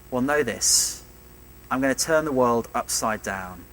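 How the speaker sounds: ordinary talk, nothing marked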